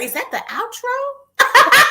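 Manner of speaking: high, loud, drawn-out mock question; mimicking co-host. Teasing co-host about the music, exaggerated high mimicry. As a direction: imitate her puzzlement, exaggerated and stretched way up high. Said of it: "She's like".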